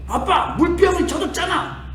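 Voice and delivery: put-on high, petulant whine of an annoyed child; imitating his son complaining about the wet bottle; irritable whine. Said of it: "신경질을 막 내면서".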